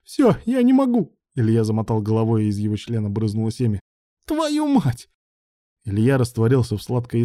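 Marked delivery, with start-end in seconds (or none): 0.0-1.1 high, loud, breaking-point cry; overwhelmed cry. A narrator's reading. Character overwhelmed, cries out. 4.3-5.1 very high, drawn-out outcry; overwhelmed curse. A narrator's reading.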